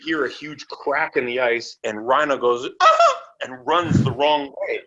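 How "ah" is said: sudden high scared yelp; a very high startled yelp. Retold or imitated. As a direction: shriek at the crack of the ice. Rhino yelps at the ice crack.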